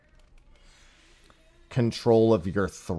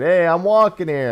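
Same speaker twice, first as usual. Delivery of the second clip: hammed-up New York tourist shout, higher and louder; New York accent; 9 semitones higher, 6 dB louder than usual. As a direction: belt it like a brash New Yorker crossing the street. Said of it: "content creator was walking through New York and said".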